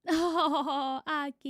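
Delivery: ordinary delivery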